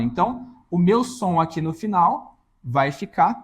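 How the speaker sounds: normal talking voice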